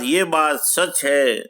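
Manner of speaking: normal talking voice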